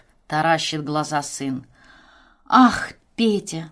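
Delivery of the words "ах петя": slow, drawn-out exclamation; exclaiming. Acted. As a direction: gasp it out in mock shock. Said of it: "восклицает супруга".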